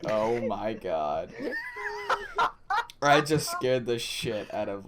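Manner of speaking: slow, laughing disbelief. Real, not acted. Telling friends about scaring the kid, laughing. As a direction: crack up mid-sentence. Laughing at his own story.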